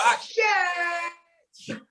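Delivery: high yelp of stinging pain; imitated kid yelling at the sting; mock pained cry. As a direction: cry out as the antiseptic burns. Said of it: "You'd be like".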